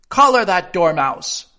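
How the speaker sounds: high, loud, furious order; shrieking. A narrator's reading. Queen ordering the dormouse seized, shrieking. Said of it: "the queen shrieked out".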